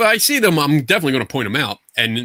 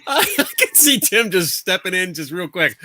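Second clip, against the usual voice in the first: laughing; 5 semitones higher than usual. Real, not acted. Laughing at the card joke.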